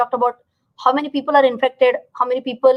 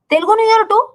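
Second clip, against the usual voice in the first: surprised; 9 semitones higher, 4 dB louder, faster than usual. Real, not acted. Reacting to chat comments live.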